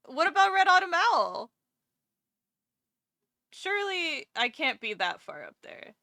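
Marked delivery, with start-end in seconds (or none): 0.1-1.5 higher and louder, indignant; mock outrage. Real, not acted. Streamer protesting to chat about the ranking, indignant.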